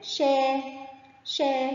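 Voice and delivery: "شا" stretched as a long shaa. Shown as a demonstration. Teacher showing pupils alef lengthening, "شا" drawn out long. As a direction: hold the aa in "شا" on a level note. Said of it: "مد بالألف".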